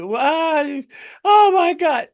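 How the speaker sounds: high, loud mock wail; panicked passengers. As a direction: shriek like a terrified passenger, hammed up. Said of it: "people are going like".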